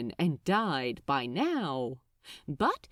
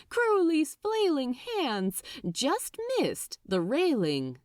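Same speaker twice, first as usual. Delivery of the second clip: ordinary delivery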